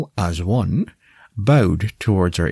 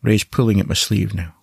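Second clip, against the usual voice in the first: ordinary delivery, nothing marked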